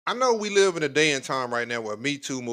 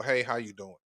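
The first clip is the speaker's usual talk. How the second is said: soft, lower smooth hello; smooth greeting demo; 5 semitones lower, 7 dB quieter than usual. Shown as a demonstration. Host demonstrates the greeting to guys, soft and smooth. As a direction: show him how easy the greeting is. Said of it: "It's just that simple".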